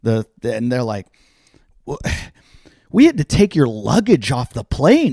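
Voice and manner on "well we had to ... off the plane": worked-up, higher-pitched scolding of a passenger; retold angry yelling. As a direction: mimic an exasperated airline worker yelling. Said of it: "like, yelling at me".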